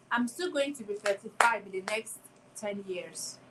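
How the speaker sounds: ordinary talk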